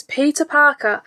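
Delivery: ordinary delivery, nothing marked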